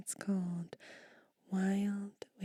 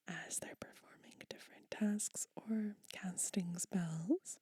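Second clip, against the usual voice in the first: usual speaking voice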